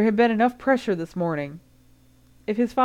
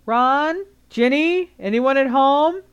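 higher and louder, calling through the house; urgent father calling out; 8 semitones higher, 7 dB louder, slower than usual. A narrator's reading. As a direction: shout for the kids from another room. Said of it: "a loud and very familiar voice".

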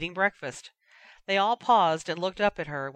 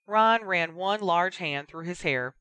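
ordinary delivery

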